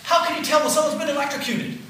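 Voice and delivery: a worried little brother asking fast; brother's worried call. Retold or imitated. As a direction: play the panicky younger brother. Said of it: "he said".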